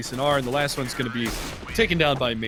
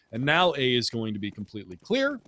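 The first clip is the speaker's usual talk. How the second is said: ordinary talk, nothing marked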